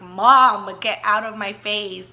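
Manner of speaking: loud exasperated 'Mom', then easing off; aggravated, annoyed. Retold or imitated. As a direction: re-enact pushing your mom away. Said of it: "I was aggravated and annoyed".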